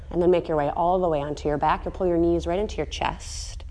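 soft, unhurried cool-down guidance; calm yoga voice. Acted. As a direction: ease the class down gently, softly.

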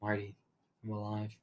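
very soft, low, halting imitation; hushed and low, with a pause after the name; mock weak murmur. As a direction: play a shot man barely speaking. Doc speaks after being shot.

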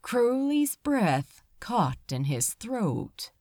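ordinary delivery

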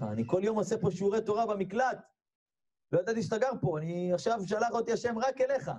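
high, urgent self-justification; anxious pleading. Retold or imitated. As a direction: explain yourself as if cornered. Trapped rabbi explains himself to the giant. (התחננות לחוצה)

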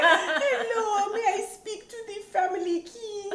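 very high, thin voice with laughter; teacher calling the house, high and laughing; tiny French teacher. Retold or imitated. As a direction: squeak it like a tiny lady with an accent. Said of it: "she's like".

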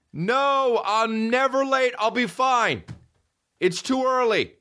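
put-on very high, insistent kid whine; imitated protesting kid. Him as a kid answering mom, high mock protest. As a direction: protest like a kid refusing to get up.

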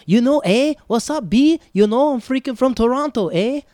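loud, high-pitched hyped-up Toronto impression; friend's Toronto slang. Imitating a friend letting her Toronto out, loud and high. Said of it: "she talked like a New Yorker".

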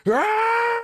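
over-the-top anime yell, way up high; Asta's scream. Retold or imitated. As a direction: burst out screaming like the anime hero over nothing. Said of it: "he's always screaming".

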